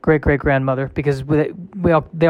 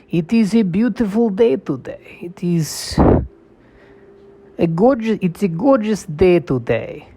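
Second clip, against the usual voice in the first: put-on cheerful line in a heavy Russian accent, higher; mock Russian accent, pitched up; 6 semitones higher than usual. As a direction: say it in a thick Russian accent, bright on top. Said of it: "with a Russian accent".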